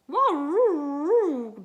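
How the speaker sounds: loud, high howling wah-roo; a dog's loud baying cry. Acted. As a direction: howl like a worried dog. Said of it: "George cried".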